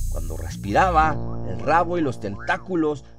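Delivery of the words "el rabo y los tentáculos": ordinary delivery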